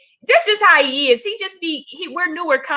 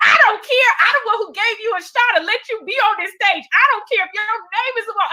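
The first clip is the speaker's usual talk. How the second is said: high mock voice of a defensive host; huffy host; 10 semitones higher, faster than usual. Host D. Ray waving off her complaint, mock huffy voice. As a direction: play the brushed-off host, quick and dismissive. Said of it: "he was like".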